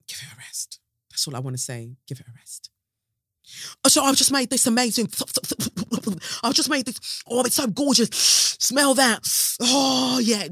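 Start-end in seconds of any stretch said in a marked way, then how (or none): 0.1-0.8 whispered, voiceless. Shown as a demonstration. Whispering it to the audience as an aside. 2.1-2.7 quiet aside. Real, not acted. 3.8-10.5 high, loud, fast gushing chef impression; mocking Jamie Oliver. Mocking the celebrity chef over his new restaurant, high and gushing. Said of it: "Jamie Oliver is opening another restaurant".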